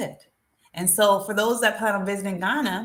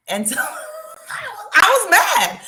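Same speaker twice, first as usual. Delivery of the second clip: laughing; 10 semitones higher, 6 dB louder than usual. Real, not acted. Laughing about getting angry.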